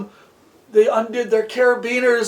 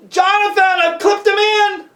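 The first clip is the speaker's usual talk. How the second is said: calling up; 8 semitones higher, 4 dB louder than usual. Acted. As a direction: holler to someone far above. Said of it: "he called up".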